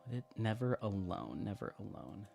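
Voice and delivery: ordinary delivery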